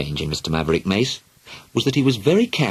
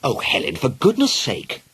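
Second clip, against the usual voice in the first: exasperated; 6 semitones higher than usual. Acted. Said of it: "her mother cried".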